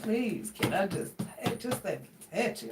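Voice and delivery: soft, whiny plea; mock pleading. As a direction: imitate a pitiful beggar. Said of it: "That fool said".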